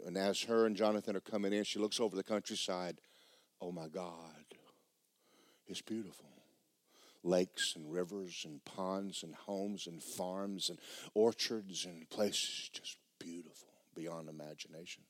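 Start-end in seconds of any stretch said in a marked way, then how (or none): 3.6-15.0 awed whisper. Acted. Girl gazing down from the jet, awed whisper. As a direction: breathe it out in wonder.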